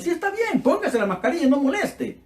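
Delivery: irritated nurse. Retold or imitated. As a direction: shush the patient like an annoyed nurse. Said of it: "Un poco incómoda".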